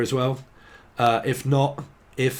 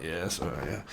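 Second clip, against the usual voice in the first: much lower, softer brush-off; awkward mumble; 7 semitones lower, 11 dB quieter than usual. Retold or imitated. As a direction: mumble it to keep them at arm's length.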